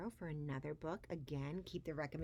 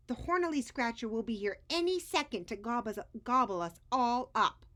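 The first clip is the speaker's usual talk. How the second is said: fast, higher, loud and pressing; urgent warning; 6 semitones higher, 7 dB louder, faster than usual. Acted. Edwin urges the prisoners to flee, urgent. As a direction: hurry them along.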